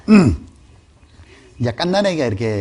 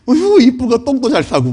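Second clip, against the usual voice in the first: put-on higher, louder cooing mother praising her baby; doting mother; 8 semitones higher, 6 dB louder than usual. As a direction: coo over the baby like a proud mom. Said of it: "하고 딱 때리는 게 아니라". (아기를 어르는 엄마 목소리)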